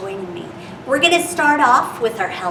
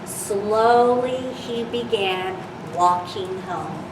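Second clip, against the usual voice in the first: gentle storybook reading voice; reading to children. Acted. Reads picture book to children.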